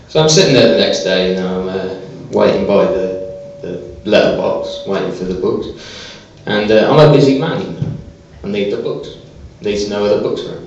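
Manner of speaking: ordinary talk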